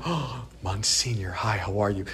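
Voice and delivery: a mock hushed hello to a holy priest; mock reverent hush. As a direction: greet him meekly, half-scared. Mock reverent greeting of the monsignor.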